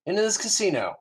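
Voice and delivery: rally him off to the casino; cheerful first mate. Acted. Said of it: "and he goes".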